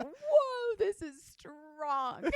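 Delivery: high, drawn-out stoned whoa; slow, high-pitched retold astonishment; mock amazement. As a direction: stretch it out, reeling in exaggerated amazement. Quotes own stoned reaction in hut.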